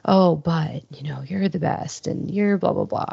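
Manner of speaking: normal talking voice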